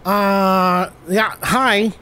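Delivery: ordinary delivery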